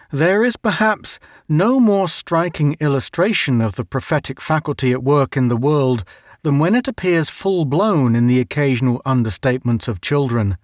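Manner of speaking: ordinary delivery, nothing marked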